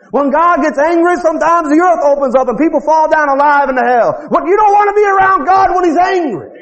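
high-pitched, driving revival preacher; full-force preaching. Real, not acted.

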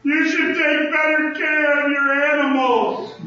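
high, slow scolding woman's voice. Retold or imitated. Imitating the woman scolding him over his dog. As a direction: mimic a nagging busybody, high. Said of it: "She says".

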